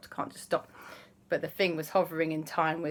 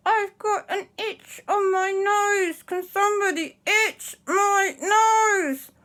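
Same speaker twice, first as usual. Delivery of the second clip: high, slow, strained whine; frozen in time; 14 semitones higher, 5 dB louder, slower than usual. Acted. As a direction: plead about an itch with a frozen mouth. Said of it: "frozen in time like this".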